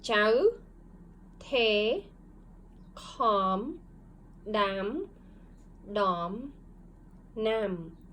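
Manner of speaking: one syllable at a time with pauses; slow choral reading. Shown as a demonstration. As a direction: read slowly together with students. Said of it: "read it together slowly".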